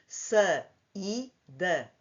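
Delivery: "Sa, i, da" split into three separate beats; syllable by syllable. Shown as a demonstration. Teacher spelling out syllables for the class. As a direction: break "saída" into its syllables slowly. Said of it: "três sílabas".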